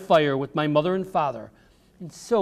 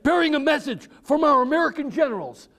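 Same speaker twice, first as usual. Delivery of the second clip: lightly raised urgent messenger; 11 semitones higher, 4 dB louder than usual. A narrator's reading. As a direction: call out the names, urgent. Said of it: "a man's voice said".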